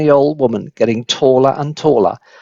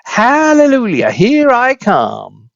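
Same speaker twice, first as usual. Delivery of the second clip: very high hallelujah, then slow and drawn out; ecstatic crone; 9 semitones higher, slower than usual. Acted. Grandma cries out in triumph as she bursts through. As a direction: sing out in mad triumph.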